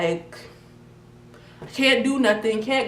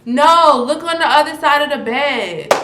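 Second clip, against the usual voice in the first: loud imitation of sister; 5 semitones higher, 10 dB louder than usual. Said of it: "She says".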